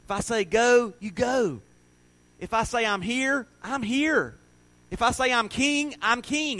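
high, slow, building declaration; emphatic divine assurance. Acted. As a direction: preach it as Jesus, each promise rising. Preacher voicing Jesus's insistent promises.

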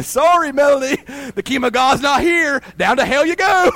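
a mock cheery dismissal; talking moose. Joking to a congregant, mock moose announcer.

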